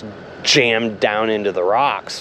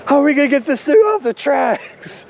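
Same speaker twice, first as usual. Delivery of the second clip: high-pitched mock panic; freaking out; 3 dB louder than usual. Coworker fearing he'll be fired, acted-out high panic. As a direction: imitate a coworker wailing in alarm, much higher voice. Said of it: "he started freaking out".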